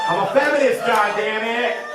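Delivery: high, loud insistence, slowing on the oath; mock-defiant shout. Real, not acted. Comic insisting to a laughing crowd, mock-defiant shout. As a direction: proclaim it as a comic protest.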